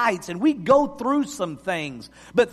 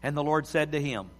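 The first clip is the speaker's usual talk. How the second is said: scripture reading; 5 semitones lower than usual. Acted. Pastor reading First Kings to his congregation, in a hushed reverent voice. Reads scripture story aloud.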